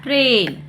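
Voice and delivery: ट्रेन with a drawn-out "ay"; long A sound. Shown as a demonstration. Teacher reading an AI example, ट्रेन with long "ay". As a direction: hold the long vowel in ट्रेन. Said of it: "ए पढ़ते हैं".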